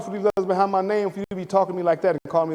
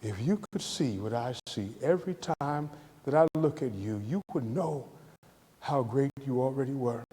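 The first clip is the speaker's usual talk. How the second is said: tender mother; 3 semitones lower, 9 dB quieter than usual. Retold or imitated. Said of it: "she says".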